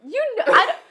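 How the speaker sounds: laughing while protesting. Real, not acted. Friends laugh at her.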